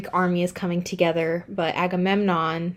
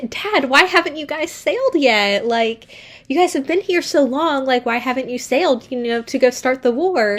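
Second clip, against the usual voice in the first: put-on higher, eager teen daughter voice; mock girly daughter; 6 semitones higher than usual. Daughter pestering her dad about sailing, high and eager. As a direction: play a naive excited daughter, pitched up. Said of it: "Iphigenia is like".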